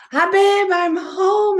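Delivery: high, loud, exuberant homecoming call; mock cheery greeting. Husband comes back in to greet his wife, exuberant mock greeting. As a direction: call it out big, like a playful returning husband. Husband's playful re-entrance, acted out.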